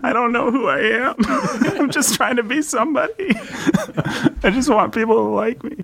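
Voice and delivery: high, mock-pitiful voice; hammed-up high-pitched self-pity; pathetic self-parody. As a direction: whimper it as a silly needy caricature.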